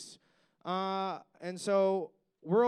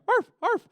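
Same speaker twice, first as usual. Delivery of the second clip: high loud yappy arf arf; dog barking imitation; 10 dB louder than usual. Comic telling the crowd about the girl who barks like a dog, high yapping. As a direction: bark it like a small dog, much higher and louder.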